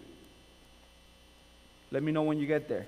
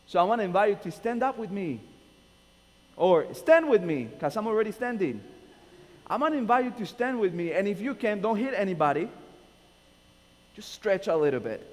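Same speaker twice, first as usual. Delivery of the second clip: higher and louder, pumping up the room; rousing invitation; 5 semitones higher, 5 dB louder than usual. Real, not acted. Pastor getting the congregation to stand, high and loud. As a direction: wake the sleepy crowd up.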